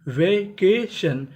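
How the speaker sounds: Vacation stretched very slowly, syllable by syllable; vacation को बहुत धीरे खींचकर बोलो; slow drawn-out vacation. Shown as a demonstration. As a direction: drag out vacation for the learners. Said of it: "टीआईओएन शन".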